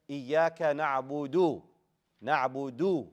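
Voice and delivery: "na'budu" slow, ending on a full "oo"; dhamma pushed out. Shown as a demonstration. Teacher drills students, dhamma pushed out. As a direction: lean into the final "du" of "na'budu". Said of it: "Push out that dhamma".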